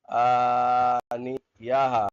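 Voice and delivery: آنیاہا drawn out slowly, final ta turned to ha; pausal form. Shown as a demonstration. Teacher demonstrating the pausal reading, آنیاہا slow with ha. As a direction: stretch آنیاہا and end it on ha. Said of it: "حال بدل جائے گی وقف میں".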